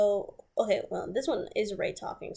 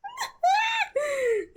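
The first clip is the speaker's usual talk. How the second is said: shrieking laugh; 6 dB louder than usual. Real, not acted. Burst after quoting him.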